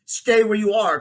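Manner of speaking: loud, high command to halt; crying out. A narrator's reading. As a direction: cry out a warning to a pursuer. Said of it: "cried Diggory".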